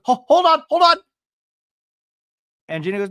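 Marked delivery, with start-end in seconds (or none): 0.0-1.0 high, loud mock "hold on"; mimicking someone urgently butting in; imitated interruption. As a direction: cut in with a high-pitched yelp. Imitating a castmate cutting in.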